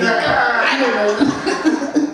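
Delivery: laughing drawn-out answer. Retold or imitated. Asked if she likes Rastas.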